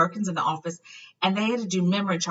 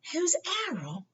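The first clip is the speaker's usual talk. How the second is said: high, soft puzzled Harry; curious young Harry; Harry asking; 13 semitones higher, 4 dB quieter than usual. A narrator's reading. Harry voiced.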